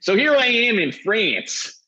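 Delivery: high, slow, put-on French accent; mock French accent. Teasing Dave in a slow, high fake French accent. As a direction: ham up a French tourist accent. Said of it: "pretend Argentinian".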